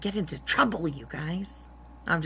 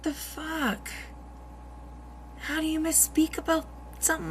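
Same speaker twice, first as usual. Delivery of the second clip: high, incredulous outburst; can't believe what you just heard; disbelief; 9 semitones higher than usual. Real, not acted. Reacting in disbelief to the clip.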